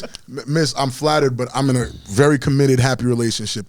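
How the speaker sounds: very low, softened, smooth polite voice. Acted. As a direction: drop deep and let the courteous refusal roll out.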